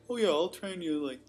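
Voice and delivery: high, bright cocky trainer voice; non-qualified trainer voice. Retold or imitated. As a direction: play a careless cheap trainer pitching hard. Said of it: "my non-qualified personal trainer voice".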